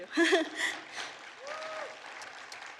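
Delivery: high nervous giggle; nervous laugh. Real, not acted. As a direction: giggle shyly at the mic. Laugh after thanks.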